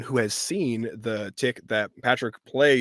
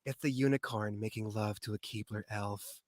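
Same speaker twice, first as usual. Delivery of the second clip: softer, understated answer; lightly voiced woman, quieter; female character voice; 3 semitones lower, 10 dB quieter than usual. Actress answering Carl on the phone, softly voiced deadpan reply. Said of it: "And she goes".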